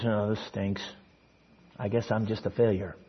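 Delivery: low, soft mock dejection; joyless. Joyless believer admitting what they really believe, mock dejected. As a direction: mumble it like a defeated churchgoer. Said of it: "And they're joyless".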